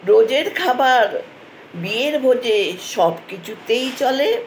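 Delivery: measured sing-song verse with pauses between phrases; poem recitation. Acted. Grandmother recites a fish poem for Bengali listeners, lilting recitation. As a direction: recite it line by line like a rhyming poem.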